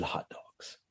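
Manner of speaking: hushed, breathy whisper of the words; blurted in a whisper; whispered answer. Retold or imitated. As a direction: whisper it like a guilty confession. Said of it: "I said".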